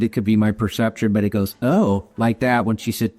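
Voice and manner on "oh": ordinary talk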